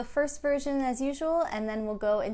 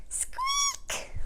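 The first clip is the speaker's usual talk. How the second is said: very high tiny mouse squeak. Pretend. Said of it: "and squeak".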